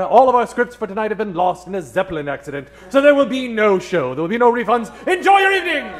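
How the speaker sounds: usual speaking voice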